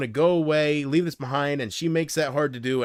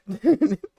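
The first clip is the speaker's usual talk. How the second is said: short high giggle; brief, higher-pitched chuckle; real laugh; 6 semitones higher, 4 dB louder than usual. Real, not acted. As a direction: giggle after apologizing. Cracks up after mock rant.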